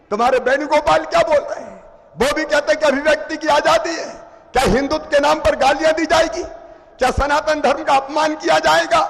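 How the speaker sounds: rapid, rising indignation; fast indignant questioning. Real, not acted. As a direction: fire off the questions.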